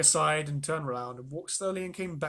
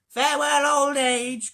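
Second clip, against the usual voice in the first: high, loud farewell cry; cried out; 10 semitones higher, 11 dB louder, slower than usual. A narrator's reading. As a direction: fling it out triumphantly. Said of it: "cried Grandma Georgina".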